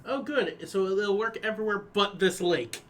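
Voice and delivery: ordinary talk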